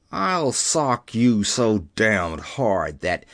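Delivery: low, slow, heavy threat; threatening. A narrator's reading. Young Tugwell squaring up to Keller, slow angry threat.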